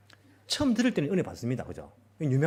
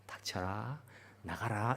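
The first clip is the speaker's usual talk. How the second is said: soft gentle; 6 semitones lower, 10 dB quieter, much slower than usual. Shown as a demonstration. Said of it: "이렇게 아니고".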